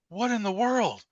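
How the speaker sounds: high, incredulous; retelling the shock of hearing the recording; stunned disbelief. Retold or imitated. Reacting to ghostly voice.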